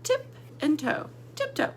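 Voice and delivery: Tip and toe then tiptoe, rising way up high; sing-song joining. Shown as a demonstration. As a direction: lift the voice as the two words snap together. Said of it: "push 'em together".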